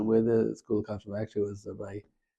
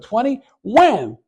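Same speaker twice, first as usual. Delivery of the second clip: slow, much higher and louder punch; mock big blow; 12 semitones higher, 9 dB louder, much slower than usual. Whipper deals the twentieth lash, hammed-up loud and slow. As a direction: land the last number like a hard hit.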